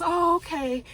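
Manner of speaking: high, drawn-out mock okay; lightly acted high-pitched woman's voice; imitated woman's agreement. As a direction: go up high for her reply.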